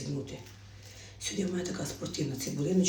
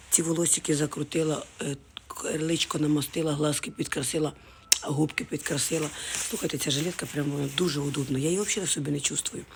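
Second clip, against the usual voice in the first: ordinary talk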